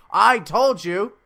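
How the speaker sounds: high, loud, drawn-out insistence; acted emphatic retort. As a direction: throw it back at the guards emphatically.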